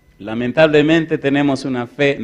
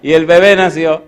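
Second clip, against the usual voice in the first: laughing; 3 semitones higher, 6 dB louder than usual. Real, not acted. Laughs telling the birth.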